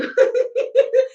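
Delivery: high, loud burst of laughter. Real, not acted. Laughing at mixing three languages.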